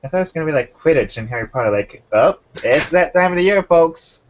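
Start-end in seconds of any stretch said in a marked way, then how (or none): 2.1-4.2 higher, louder mock announcer voice; hammed-up announcer. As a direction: call it out to the crowd like an announcer. Acted announcer-style quote about the sport.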